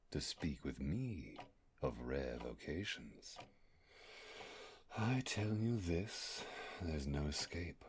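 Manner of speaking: slow, dramatic verse read as a threat; ominous poem recital. Acted. Slow menacing verse to the lost astronaut. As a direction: intone each line slowly like a doom-laden poem. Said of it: "Did you like that?".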